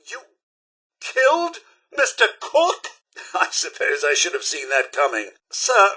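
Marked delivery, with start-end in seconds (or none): 0.1-2.9 halting, then high and incredulous; shocked disbelief. Acted. Butler learning Mister Cook was killed, shocked disbelief. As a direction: pause aghast, then rise sharply on the question.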